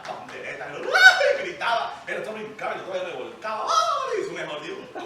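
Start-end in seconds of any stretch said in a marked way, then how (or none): 1.0-1.4 very high, loud, laughing "¡ay!" shriek; mock revival shriek. Mimics a shout at a revival. 3.7-4.2 very high, loud, laughing "¡ay!" shriek; mock revival shriek. Mimics a shout at a revival.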